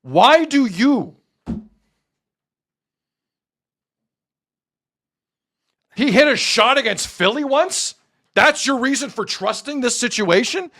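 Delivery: high, slow, exasperated rant; heated rant, pitch way up; angry rant. Real, not acted. Rant at chat about Kawhi.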